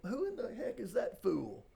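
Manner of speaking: indignant. Retold or imitated. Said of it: "And I'm like".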